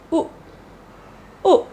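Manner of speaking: U as a brief "oo" as in put; short U. Shown as a demonstration. Teacher showing short U sound, brief vowel. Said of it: "The next short U sound".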